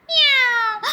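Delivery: very high, loud imitated meow; cat meow. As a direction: yowl suddenly like a cat. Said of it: "the meow of a cat".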